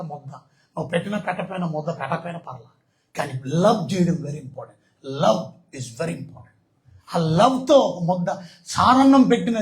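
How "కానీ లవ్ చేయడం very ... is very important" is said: slow emphasis. Real, not acted. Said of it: "slow emphatic moral point".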